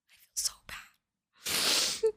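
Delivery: slow, voiceless tearful whisper; emotional whisper. Real, not acted. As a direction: let it fade into a drawn-out whisper. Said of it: "why am I getting emotional".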